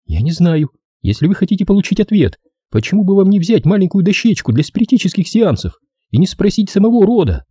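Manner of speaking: high-pitched woman's voice; Della, irritated. A narrator's reading. Narrator voices Della in dialogue.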